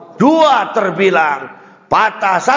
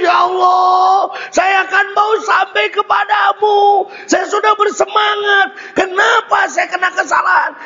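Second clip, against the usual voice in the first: tearful prayer; 12 semitones higher than usual. Retold or imitated. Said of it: "kita nangis".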